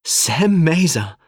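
high, loud, drawn-out name; delighted exclamation. A narrator's reading. Sadie greets Sam while hugging him, exclaiming with delight. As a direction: greet a long-lost friend with delight.

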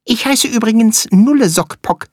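high, quick little flea voice; squeaky tiny creature. Acted. Flea character speaks.

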